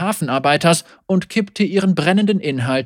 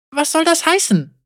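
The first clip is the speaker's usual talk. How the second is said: bewildered; 10 semitones higher than usual. Acted. Tamara confused, upset.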